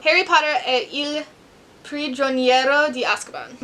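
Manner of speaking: second, steadier try at the Italian title; careful Italian accent. Shown as a demonstration. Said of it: "My Italian accents in my head are prime".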